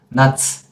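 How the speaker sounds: Nuts ending on a released s; wrong pronunciation. Shown as a demonstration. Teacher warns against this reading, Nuts with released ending. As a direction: let the end of Nuts hiss out wrongly. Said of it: "It is not".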